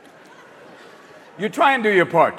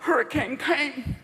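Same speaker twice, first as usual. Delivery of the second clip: dazed survivor; 6 semitones higher, 6 dB quieter than usual. Retold or imitated. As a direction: talk like a stunned homeowner in the wreckage. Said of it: "they're always going like".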